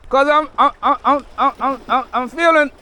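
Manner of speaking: high, loud, stammering hype; excited shout. Real, not acted. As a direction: shout it out playfully. Loud high joking chant.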